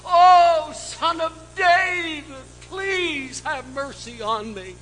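desperate woman begging the Messiah through tears; tearful plea. Retold or imitated. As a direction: beg through tears, voice lifting to a cry. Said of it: "she's there with tears running down her cheeks".

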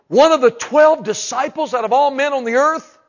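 preaching at full force. Real, not acted.

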